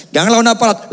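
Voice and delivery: mock officer's warning, pitched high; stern warning. Officer grabbing the driver's neck, stern mock warning. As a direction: imitate a policeman warning a resisting driver.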